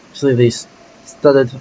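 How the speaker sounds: usual speaking voice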